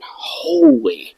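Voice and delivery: high, drawn-out astonished holy; stunned disbelief. Real, not acted. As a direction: stretch holy out slowly in open-mouthed shock. Shocked on meeting the army dad.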